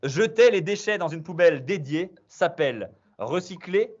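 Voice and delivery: bright quiz-master hype; quiz host announcement. Acted. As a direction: pump up the players as you read the question. Host announces double-points question.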